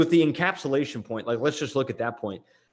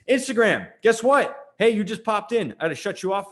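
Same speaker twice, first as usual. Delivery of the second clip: raised, higher-pitched call to viewers; 9 semitones higher, 4 dB louder than usual. Real, not acted. Host breaks off to address Instagram viewers, calling out. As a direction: call out to grab their attention.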